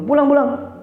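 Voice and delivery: high-pitched retold dismissal; mock angry intonation. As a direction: act out shooing someone away crossly. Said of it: "tapi intonasinya marah". (pura-pura marah menyuruh pulang)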